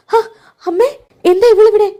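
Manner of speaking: sudden high, loud shock at seeing her; high, loud appalled cry; shocked outburst. Acted. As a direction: blurt it out, appalled. Anamika sees the unwanted child.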